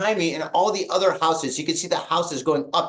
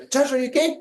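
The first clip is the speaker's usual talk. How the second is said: high-pitched concerned question, imitated; anxious calling; 7 semitones higher, faster than usual. Owner checking on Josh after the quake, anxiously high. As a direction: call to the pet, voice lifted.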